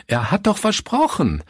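the second cry climbs into despair. A narrator's reading. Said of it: "rief Pastor Fritz".

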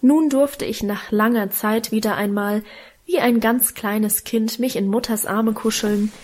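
ordinary delivery, nothing marked

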